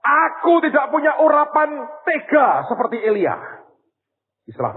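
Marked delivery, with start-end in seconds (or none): 0.0-3.4 high, loud anguished cry, slowed; crying out to God. Retold or imitated. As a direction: scream your prayer in anguish. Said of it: "Saya jerit". (jeritan doa yang pedih dan tinggi)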